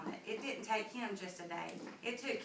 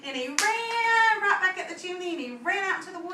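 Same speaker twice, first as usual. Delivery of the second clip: louder, higher, hurried telling; excited narration; 8 semitones higher, 11 dB louder than usual. A narrator's reading. Narrator telling the child the wolf ran off, louder and higher. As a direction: race through the escape.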